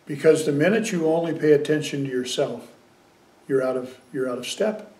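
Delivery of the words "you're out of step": ordinary delivery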